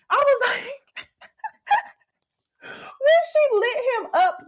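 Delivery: high, laughing through the words; can barely get it out for laughing; real giddy laughter. Real, not acted. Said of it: "I laughed my ass off".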